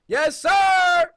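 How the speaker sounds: loud, high whoop of delight; excited cheer. Real, not acted. Gamer finding an energy drink, excited cheer. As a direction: holler it in triumph. Finds an item.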